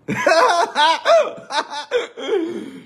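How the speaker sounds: very high, long laugh; high cackle. Real, not acted. Comedian after his cheeky punchline, high cackle.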